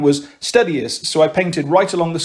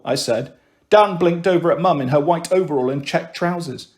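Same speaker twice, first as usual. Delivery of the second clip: ordinary delivery, nothing marked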